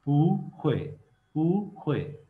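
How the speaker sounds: पू in second tone before a falling ख्वे; tone change demo. Shown as a demonstration. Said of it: "fourth tone में से second tone पे". (पू second tone में, ख्वे गिरती हुई फोर्थ टोन में)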